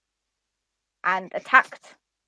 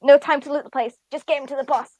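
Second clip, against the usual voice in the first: mimicked thug; 5 semitones higher, 6 dB louder than usual. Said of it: "the other thing it says is".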